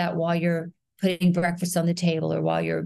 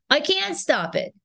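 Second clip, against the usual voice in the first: high, emphatic Queens accent; overdone Queens accent; 9 semitones higher than usual. Shown as a demonstration. Actor showing the accent before it relaxed, overdone Queens. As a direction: push "can't" up high in a stiff Queens accent. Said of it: "rather than saying".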